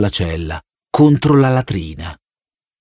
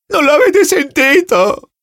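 much higher, slow desperate cry; shrieking plea; 3 dB louder, slower than usual. Acted. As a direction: shriek it in terror.

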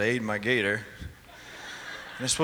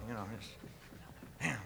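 usual speaking voice

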